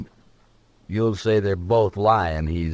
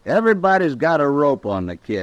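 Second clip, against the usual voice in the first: higher and louder, pleading against the marshal; heated protest; 6 semitones higher, 4 dB louder than usual. Acted. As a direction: raise your voice in protest. Shin arguing loudly for the kid.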